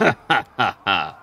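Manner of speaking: short menacing demon laugh; evil cackle. Acted. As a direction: cackle like a fiend.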